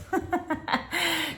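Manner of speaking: high, breathy giggle; laugh. Real, not acted. Mom corrected by her listening student, high giggle.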